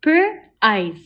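Per-eyes split into onset and long vowel; segmented. Shown as a demonstration. Teacher sounding out Per-eyes for the class. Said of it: "Say it with me".

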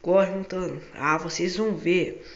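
loud playful threat, slowing down; mock angry chase. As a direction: yell after them in mock fury. Chasing friends, threatening.

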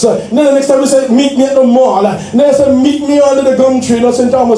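rising, full-voiced preaching over the organ. Pastor preaching to the church, at full force. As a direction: preach it out to the congregation, building.

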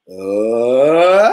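uh that slides from low up high; rising glide. Shown as a demonstration. Teacher slides the voice up like a roller coaster. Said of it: "Low to high".

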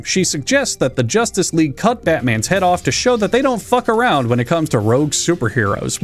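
ordinary delivery

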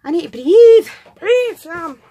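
loud, high overexcited outburst; too excited, telling herself to calm down. Real, not acted. Opening a parcel from Liz, overexcited outburst to herself. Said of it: "I get too excited".

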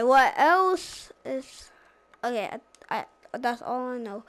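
ordinary delivery

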